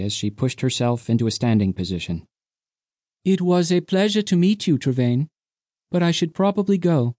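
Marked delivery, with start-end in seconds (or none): 3.2-7.1 put-on higher, playful young woman; higher voiced young woman teasing the captain. A narrator's reading. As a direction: tease him lightly. Undina leaving.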